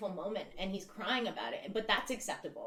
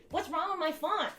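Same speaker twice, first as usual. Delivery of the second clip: mock offended; 8 semitones higher than usual. Mock-offended echo of line 4.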